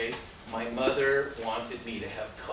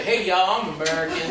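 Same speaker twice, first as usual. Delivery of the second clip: loud, higher, laughing boast; proud announcement; 6 semitones higher, 8 dB louder than usual. Retold or imitated. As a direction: call it out to the border guards, chuckling. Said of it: "When I'd come back and say".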